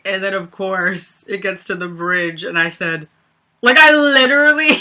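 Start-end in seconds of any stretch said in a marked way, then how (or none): 3.6-4.8 words dissolving into a high laugh; real laughter. Real, not acted. Recalling the song's bridge, breaking into laughter. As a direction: laugh through it. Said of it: "I actually died laughing".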